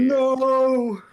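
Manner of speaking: sudden high, loud "No!" of alarm; alarmed shout. Real, not acted. Artist yells when the upload seems stuck, real alarm. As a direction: yelp it out in real panic. File seems not to send.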